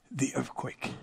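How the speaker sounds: near-whispered, trailing into awe; hushed. Acted. Preacher reading scripture to the congregation, hushed. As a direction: drop to a breathy hush.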